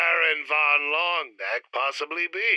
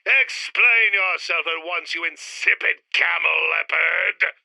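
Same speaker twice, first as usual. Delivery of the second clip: loud indignant demand; louder, affronted villain; outraged; 3 semitones higher, 5 dB louder than usual. Acted. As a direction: bark the insult at him, furious.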